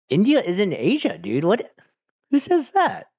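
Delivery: high, loud disbelief; incredulous. Real, not acted. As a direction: blurt it out in high-pitched disbelief. Incredulous reply to ignorant claim.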